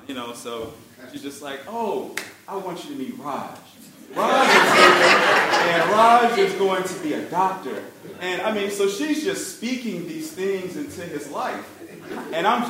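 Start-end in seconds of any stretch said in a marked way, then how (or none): 1.7-7.8 a hint of a proud grandma. Grandma proudly introducing Raj.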